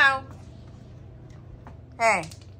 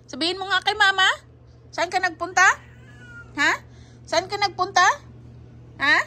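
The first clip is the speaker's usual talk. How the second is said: pet talk; 7 semitones higher, faster than usual. Real, not acted. Questioning the cat as mama.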